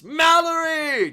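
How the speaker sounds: high, drawn-out greeting shout; crowd's cheer. Acted. Everyone in the bar hails granny, cheering shout.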